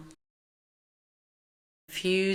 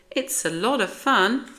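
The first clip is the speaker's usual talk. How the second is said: lilting, louder coaxing; high, enthusiastic persuasion; cheery encouragement; 5 semitones higher, 5 dB louder than usual. A narrator's reading. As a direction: coax them with upbeat emphasis. Teacher coaxes cats into pool.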